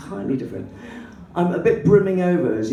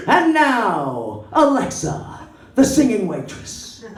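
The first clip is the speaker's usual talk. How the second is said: loud, higher showman's introduction; stage announcer; 5 semitones higher, 4 dB louder, much slower than usual. Retold or imitated. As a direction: announce the act with drawn-out flourish. Keyboard player announces her from stage.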